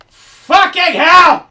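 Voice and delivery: shocked outburst; startled yell. Real, not acted. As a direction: yelp it in fright. Startled by ghost hand.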